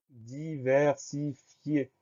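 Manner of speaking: very slow, drawn-out syllables; syllable-by-syllable dictation. Shown as a demonstration. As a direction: stretch each syllable for dictation. Dictation word stretched out by syllables.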